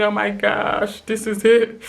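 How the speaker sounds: high, mock-crying dread; tearful despair. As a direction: imitate crying that the end has come. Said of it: "crying and just shaking and thinking".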